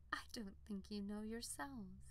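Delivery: whispered thought; soft whisper. Acted. Unicorn to the farmer, whispered musing. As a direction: let it fall as a hushed aside.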